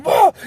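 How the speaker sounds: high, loud mock "whoa"; imitated alarmed yelp. Man slipping on greased shoes, startled yell. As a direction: yell like someone losing their footing.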